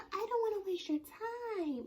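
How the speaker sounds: very high mock pleading; retold protest. She tells him she won't waste his time, mock pleading. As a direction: imitate her protest way up high.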